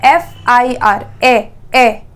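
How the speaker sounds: एफ़आईआर said slowly, opening on a clear eh; एफ़आईआर slow, its first sound ए isolated; eh sound at start. Shown as a demonstration. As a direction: stretch out एफ़आईआर and repeat the eh. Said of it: "वहाँ ए आता है".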